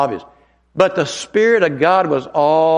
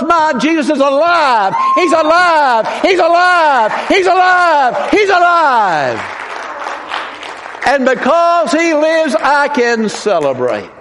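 preaching at full force; 10 semitones higher, slower than usual. Real, not acted. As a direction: proclaim it like a revival preacher. Preaching climax.

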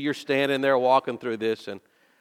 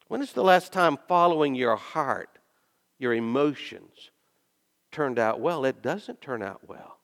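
ordinary talk

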